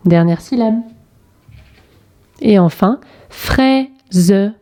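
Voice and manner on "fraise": "fraise" split into frai, ze, final e voiced; syllable by syllable. Shown as a demonstration. Teacher showing the first syllable, syllable by syllable. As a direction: sound out "fraise" in two separate beats. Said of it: "première syllabe".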